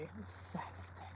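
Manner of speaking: hushed and sorry, almost no voice; breathy sad whisper. Real, not acted. Sad story of the dog and bunny.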